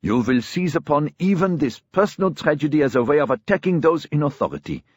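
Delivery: high, heated accusation; furious. A narrator's reading. Brother accusing his sister over the tragedy, furious. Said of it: "Eric said furiously".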